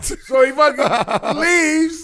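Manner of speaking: loud excited laughing retelling. Real, not acted. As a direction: build the climax laughing. Excited climax of the raid.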